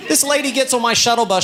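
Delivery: ordinary talk, nothing marked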